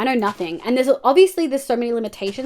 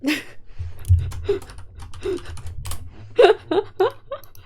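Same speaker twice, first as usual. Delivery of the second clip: breathy shaken laugh; 9 semitones higher than usual. Real, not acted. Laughing after goat scare.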